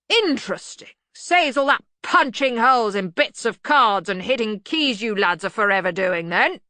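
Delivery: loud, hearty interest; blustering Arch-Chancellor. Acted. Arch-Chancellor quizzing lads about their machine, hearty. Ridcully character dialogue.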